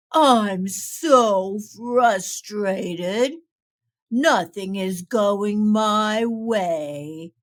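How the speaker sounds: low, slow, grumbling turtle voice; frustrated turtle. Acted. Gloomy Turtle tells Pete his troubles, slow and low. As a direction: drag the words out, fed up.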